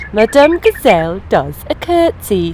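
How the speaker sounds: usual speaking voice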